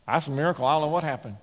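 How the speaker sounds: astonished doctor. Retold or imitated. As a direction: act out a doctor's amazement. Acted astonished doctor after recovery.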